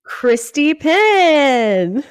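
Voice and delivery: high, loud, drawn-out hype introduction; excited announcement. Real, not acted. Host announcing her mom as special guest, excited. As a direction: stretch the name out like a cheering announcer.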